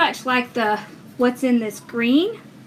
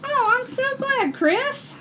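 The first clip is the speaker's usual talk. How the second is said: high, slow, delighted gush; 12 semitones higher, much slower than usual. Real, not acted. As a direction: stretch it out up high, touched. Thanking a viewer warmly.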